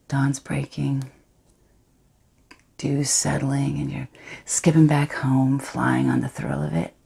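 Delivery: Astrid, guilty yet thrilled, hushed and intimate; a woman savouring her secret betrayal; defiant confessional monologue. Acted.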